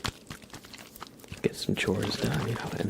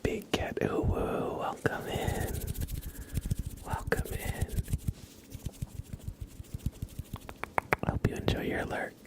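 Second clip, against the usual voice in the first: ASMR whisper; 7 semitones higher than usual. Acted. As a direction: whisper intimately while brushing. ASMR brushing and chat.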